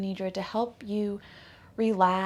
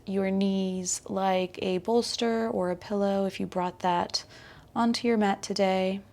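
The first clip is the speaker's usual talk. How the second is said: guided meditation; 4 dB louder than usual. Acted. Yoga teacher guiding her class into yogic sleep, calm guided meditation. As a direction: ease the listener down into rest, unhurried.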